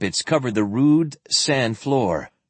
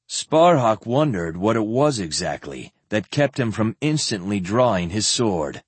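plain reading voice

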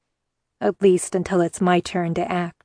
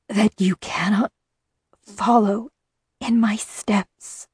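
soft strained woman's voice; slower than usual. A narrator's reading. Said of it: "came a soft, strained female voice".

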